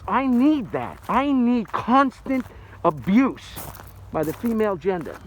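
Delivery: high, loud and drawn out, begging for it; mock pleading shout. Man joking to women viewers, loud mock plea. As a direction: overplay the desperate need, slowing right down. Comic plea for a nagging wife.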